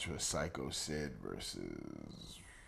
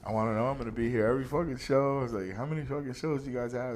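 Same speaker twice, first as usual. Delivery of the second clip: eager excitement; 6 semitones higher, 8 dB louder, faster than usual. Retold or imitated. Excited fan signing up.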